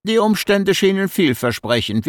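calculating, without warmth; cold and calculating. A narrator's reading. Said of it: "Die Antwort hingegen war bar jeder Wärme".

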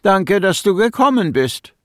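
polite, friendly greeting. A narrator's reading. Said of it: "Sie klangen höflich, freundlich gar".

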